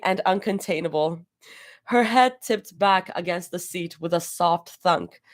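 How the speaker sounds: ordinary delivery, nothing marked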